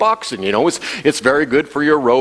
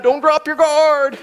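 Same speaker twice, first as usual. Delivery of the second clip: much higher, slow, yelled warning; coach yell; 12 semitones higher, slower than usual. Retold or imitated. Boxing coach yelling at the young fighter from the sideline. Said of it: "the coach yelling from the side".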